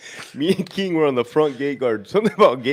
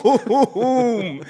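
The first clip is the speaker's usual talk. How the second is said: high, drawn-out mock blast through laughter; imitated explosion boom; 9 semitones higher than usual. Retelling a rocket landing in front of him, imitated boom.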